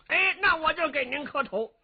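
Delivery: put-on high, loud, blunt country-boy voice; brash simpleton warrior. Young warrior cheekily addresses the old emperor, high and brash. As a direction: play the dim-witted strongman, cheeky and loud.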